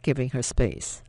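ordinary delivery, nothing marked

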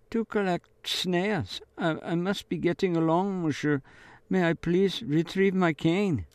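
put-on a scared girl begging to leave; frightened stammer. A narrator's reading. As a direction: stumble over the words in fear.

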